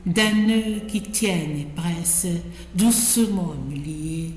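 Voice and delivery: measured poem reading with pauses between phrases; poetry recitation. Acted. Reader recites Ronsard's ode to Cassandre, measured poetic recitation. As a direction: recite it line by line, giving each phrase room.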